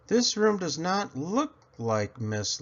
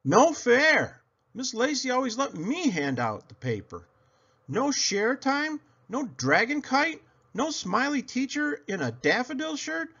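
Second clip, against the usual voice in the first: put-on high, sulky child voice; whiny kid complaint; 11 semitones higher than usual. A narrator's reading. Girl complaining to herself about her new class, high and whiny. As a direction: whine like a fed-up six-year-old.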